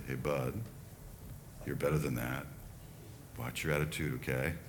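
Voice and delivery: low, soft, steady coaching voice; calm quiet correction. Retold or imitated. Coach quietly correcting a soccer player, low and calm. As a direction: talk him down gently and quietly. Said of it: "That was a little different than".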